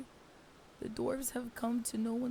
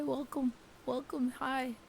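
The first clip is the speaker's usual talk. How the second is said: ordinary talk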